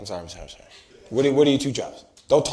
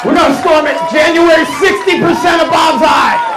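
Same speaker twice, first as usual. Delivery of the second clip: high, shouted battle cry; rabble-rousing shout, hammed up; mock rally cry; 14 semitones higher, 9 dB louder than usual.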